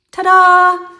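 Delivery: high, loud showy ta-da; ta-da flourish. Real, not acted. Wrapping up her advice with a triumphant ta-da. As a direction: present it like a magic trick. Triumphant reveal after example.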